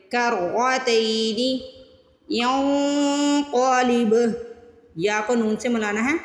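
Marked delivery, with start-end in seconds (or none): 0.1-4.1 کرتین with the ک flowing straight into a heavy ر; joined kaf and ra. Shown as a demonstration. Teacher demonstrating letter joining to students, slow chant. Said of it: "کاف کو را سے ملائیں گے".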